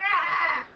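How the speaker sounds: sudden loud pained yelp; retold yelp of pain. Telling friends about nicking her ankle shaving, loud yelp. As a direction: cry out as the razor nicks the ankle. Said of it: "And I'm like".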